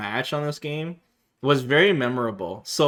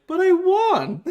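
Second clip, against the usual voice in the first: much higher squeaky But I won; highest pitched voice; 4 dB louder, slower than usual. Retold or imitated. Kicked-off player protesting to the Capcom rep, squeaky. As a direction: whine it like a tiny indignant kid. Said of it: "the highest pitched voice I could imagine".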